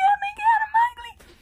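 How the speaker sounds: high, loud sobbing wail; crying. Retold or imitated. Bride sobbing in the bathroom over missing eyebrow, high and loud. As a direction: wail it through tears.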